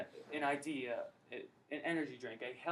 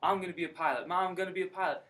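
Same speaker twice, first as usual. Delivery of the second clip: louder, higher burst of excitement; psyched; 4 semitones higher, 6 dB louder than usual. Retold or imitated. Student telling his mom about becoming a pilot, psyched. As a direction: proudly blurt it out, re-enacted excitement. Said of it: "I was psyched".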